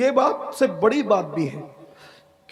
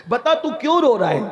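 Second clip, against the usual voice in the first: higher, pressing repeat of the question; insistent question; 6 semitones higher, 3 dB louder than usual. Retold or imitated. The master asks the weeping youth why he cries, insistent. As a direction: press him for an answer, voice raised in pitch.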